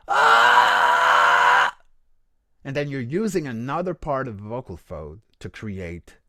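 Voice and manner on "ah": long loud distorted ah; simple rough effect. Shown as a demonstration. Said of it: "So this is called simple effect".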